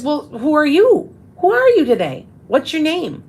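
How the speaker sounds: put-on lilting mother asking her toddler; asking a small child. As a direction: coax it out of a little kid. Asking three-year-old daughter gently.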